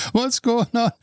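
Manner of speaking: high, alarmed shout; panicked yell. Retold or imitated. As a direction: cry out while being yanked off your feet. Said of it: "yelling like crazy".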